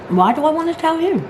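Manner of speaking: high, exasperated disbelief; incredulous protest. Retold or imitated. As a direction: throw the question back in disbelief. Retold protest to receptionist.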